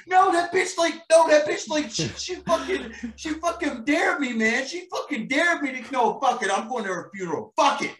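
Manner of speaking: hammed-up furious rant in a high voice; pissed-off elephant. Elephant fuming over the lady's dare, high ranting yell. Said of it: "so pissed off. He's like".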